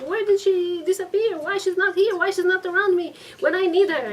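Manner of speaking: high, loud indignant griping; angry husband's complaint. Retold or imitated. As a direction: whine it like a sick husband left alone. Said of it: "And he's angry".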